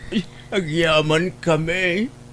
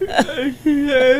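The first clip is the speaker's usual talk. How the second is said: crying; 7 semitones higher than usual. Acted. Uncle moved, ashamed before niece.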